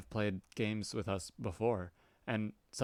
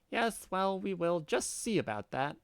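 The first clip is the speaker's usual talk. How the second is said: put-on much higher, childish defiant cat girl; 9 semitones higher than usual. Cat girl refusing the troll's order, high and childish. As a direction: squeak back like a defiant kitten.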